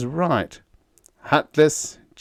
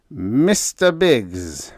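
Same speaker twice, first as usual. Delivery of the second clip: slow, louder seething address; raging; 4 semitones higher, 5 dB louder, much slower than usual. A narrator's reading. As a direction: drag his name out in rage. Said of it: "raged the efficiency expert".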